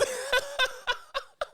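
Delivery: long high-pitched laugh; high laughter. Real, not acted. Laughing at Kamala tweet.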